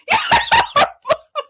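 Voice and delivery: a very high, loud cackle; high squealing laugh; shrieking laugh. Real, not acted. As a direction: cackle at your own sass. Laughs after her own retort.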